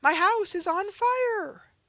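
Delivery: high, loud, slow cry of alarm; alarmed exclamation. Acted. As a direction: shout it like real danger. Reading an exclamatory example.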